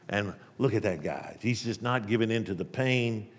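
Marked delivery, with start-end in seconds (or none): none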